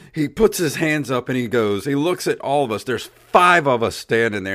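usual speaking voice

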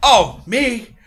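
loud, hearty dwarf rambling; jolly dwarf. Acted. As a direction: play the cheery old dwarf chatting. Dwarf answering.

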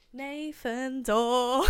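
loud, stretched-out announcement; gleeful big-news reveal; excited reveal. Real, not acted. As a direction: draw the name out slowly and loudly. Revealing whose tickets she has.

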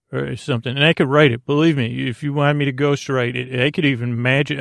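normal talking voice

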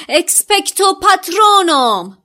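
high, loud cry of the spell; shouted spell. Acted. Harry leaping from the bush to cast, shouting. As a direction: hurl the incantation at the dementors. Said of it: "فریاد زد".

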